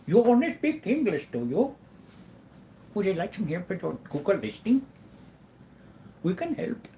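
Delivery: high-pitched Indian call-centre caller impression; mock Indian accent. Google listing caller offering help, mock Indian accent. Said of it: "I can't do my Google listing".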